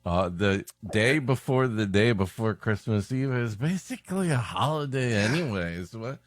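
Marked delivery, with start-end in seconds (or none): none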